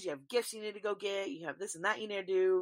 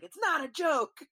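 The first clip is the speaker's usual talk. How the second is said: angry mom; 9 semitones higher, 4 dB louder than usual. Retold or imitated. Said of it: "she's like".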